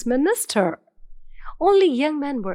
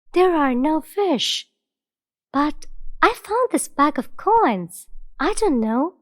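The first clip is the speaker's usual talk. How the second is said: lightly voiced sincere youth; honest young Abdullah; 5 semitones higher than usual. Abdullah speaks to king.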